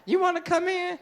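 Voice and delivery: very high, sweet invitation; high woman's voice. Acted. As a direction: invite him in like the date.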